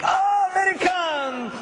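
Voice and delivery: high, loud, slow welcoming calls; excited Japanese hosts. Retold or imitated. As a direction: call out to the foreigners with delight. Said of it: "and they're like".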